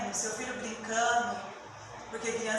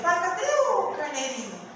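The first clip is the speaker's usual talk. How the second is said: put-on higher, small boy's voice, trusting; young Isaac asking; 6 semitones higher, 4 dB louder than usual. Preacher voicing Isaac asking his father about the lamb, higher child voice. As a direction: ask innocently like a little boy. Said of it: "Quando Isaac fala pra ele".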